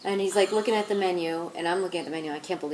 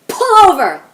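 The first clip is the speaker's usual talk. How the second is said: sudden high, very loud shout; awful angry yell; 12 semitones higher, 16 dB louder, slower than usual. Retold or imitated. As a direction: bellow it like a furious driver. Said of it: "like that, in this really awful voice".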